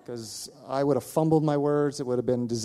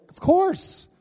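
eager yes; 8 dB louder than usual. Retold or imitated. Eager agreement.

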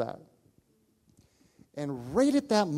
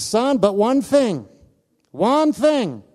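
higher, much louder, slowed; calling him back; 9 semitones higher, 12 dB louder, much slower than usual. Retold or imitated. Dying man calls the leaving pastor back, loud. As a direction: stop him at the door with emphasis.

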